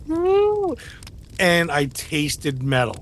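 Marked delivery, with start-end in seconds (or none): 0.1-0.7 high-pitched "woo" through a bitten tongue; high startled whoop. Retold or imitated. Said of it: "biting my tongue and going like".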